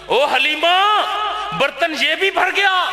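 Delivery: husband calling Halima, amazed at the milk; excited call. Acted. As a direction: call out in wonder. Husband calling again, more excited.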